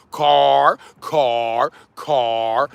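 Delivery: "car" repeated slowly like a teacher; pronunciation drill. Shown as a demonstration. Streamer leading Chicago in a chant of car. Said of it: "Say it with me, Chicago".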